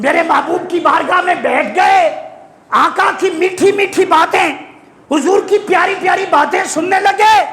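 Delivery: high, ringing sermon voice; fervent, high-pitched pulpit declamation; impassioned preaching. Real, not acted. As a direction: declaim it to a responsive congregation. Preaches at full force.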